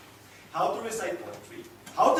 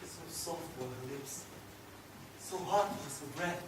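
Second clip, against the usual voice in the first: tender reminiscence; 2 semitones lower, 9 dB quieter than usual. Acted. Man recalling a childhood love's kiss, tender. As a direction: savour each memory quietly, with long pauses. Recalls his first love.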